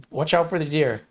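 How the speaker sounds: lightly imitated Midwest warning; put-on Upper Midwest accent. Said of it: "the way he's talking".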